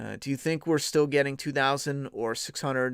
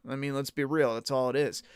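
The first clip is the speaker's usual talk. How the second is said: ordinary delivery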